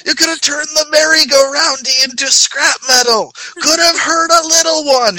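high, shouted scolding rant. Retold or imitated. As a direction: rant at them like a fussy nanny. Imitating Kimmy carrying on.

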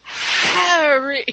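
exaggerated breathed-out name. Hermione finding Harry, breathes his name. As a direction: sigh the name out on a long breath. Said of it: "she breathes".

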